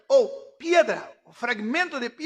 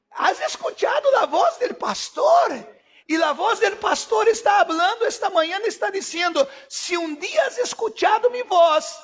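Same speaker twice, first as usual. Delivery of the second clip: impassioned preaching; 3 semitones higher, 4 dB louder than usual. Acted. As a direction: preach it out to the congregation. Predica a plena fuerza. (predicación ferviente a la congregación)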